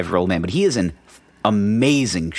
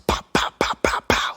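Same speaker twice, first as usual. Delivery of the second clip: voiceless popping noises, like pop rocks; rapid-fire mouth pops without voice; imitated popping. Joints cracking down the stairs, imitated popping. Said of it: "it sounds like pop rocks".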